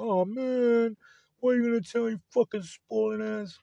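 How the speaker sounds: high, mocking whine of an annoyed viewer; whiny complainer. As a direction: mimic a whiny guy mad about spoilers. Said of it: "somebody will be like".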